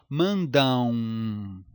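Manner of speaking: "mandão" bem lento e mais grave, final em ãu; ditongo nasal decrescente. Shown as a demonstration. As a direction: estique o final de "mandão" e deixe cair. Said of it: "O som decresceu". ("mandão" arrastado, som que decresce)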